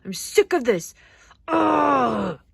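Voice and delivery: loud, exasperated venting; mad and frustrated. Acted. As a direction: let the frustration build to a loud groan. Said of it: "I'm frustrated".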